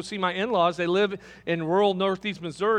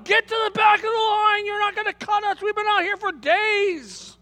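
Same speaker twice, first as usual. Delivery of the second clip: yelled from the back of the line, much higher; angry crowd yelling; 11 semitones higher than usual. Retold or imitated. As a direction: bark it like an impatient crowd in line. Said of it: "people are yelling at him and screaming".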